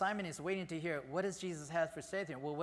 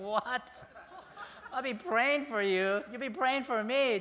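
higher, louder, laughing disbelief at I'll be praying for you; 8 semitones higher, 4 dB louder, slower than usual. Retold or imitated. Peter stunned by Jesus' answer, high and laughing. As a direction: echo the words back in stunned disbelief. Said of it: "imagine Peter going".

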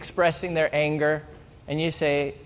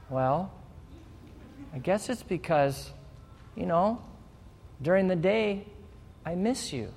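hurt, vulnerable partner; 2 semitones higher, 7 dB quieter than usual. Pretend. Partner admits missing them.

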